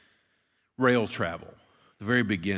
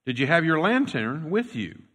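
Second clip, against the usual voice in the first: raised, loud interrogating voice; pressing question; 7 semitones higher, 7 dB louder than usual. Retold or imitated. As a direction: push the question hard and high. Chairman grilling Ben.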